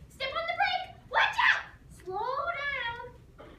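mom's voice screaming in daughter's dreams. Retold or imitated. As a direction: scream it like a nightmare mom. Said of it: "that voice, it began to follow me".

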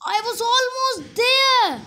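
stretched-out, groaning "I was almost there"; agonized slow whine; frustrated groan. Real, not acted. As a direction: drag every word out in dismay. Just failed the jump.

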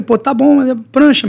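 high, loud and keen; eager agreement. Retold or imitated. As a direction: jump at the invitation. Excited agreeing to go. (topando animado)

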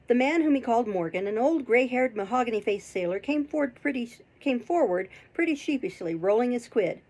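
ordinary delivery, nothing marked

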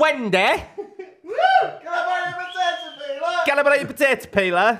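very high, loud hollering neighbour; Vicky. Acted. Neighbour asks Wendy to lend a potato peeler, high hollering voice. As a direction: shriek the request in a daft high voice. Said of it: "Vicky, who borrows potato peelers".

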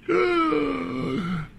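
high crying gasp for breath; a real sob catching in the throat; sharp tearful intake of breath; sobbing gasp. Real, not acted. Mourning the lost wilderness.

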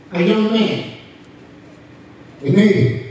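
normal talking voice

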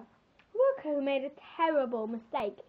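usual speaking voice

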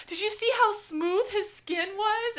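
high, swooning fanboy voice; starstruck gushing. Retold or imitated. Wife mimicking husband swooning over Paul Rudd, high and gushing. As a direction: gush like a smitten fan. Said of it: "you guys were like".

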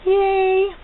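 high, loud happy yay; excited cheer. Real, not acted. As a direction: cheer like you just got the best present. Cheering over earrings.

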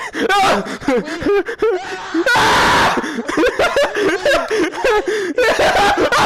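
high shrieking laughter; screaming, helpless cackles; hysterical laughter. Real, not acted. Player in hysterics after falling, shriek-laughing.

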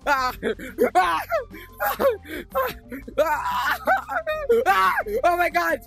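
high, loud startled cries; frightened yelps. Real, not acted. Player chased by monsters, yelping in fear. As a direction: shriek each time something jumps out. Fleeing monsters in horror game.

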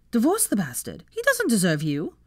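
ordinary delivery, nothing marked

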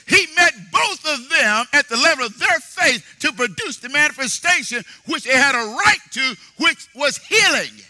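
high, loud preaching with shouted peaks; fiery sermon climax; full-force preaching. Acted. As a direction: drive the faith message home to the congregation.

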